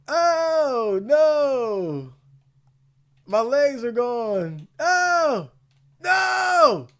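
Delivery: high, loud frightened wail; panicking gamer. Real, not acted. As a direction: whimper out in panic. Player being hurt.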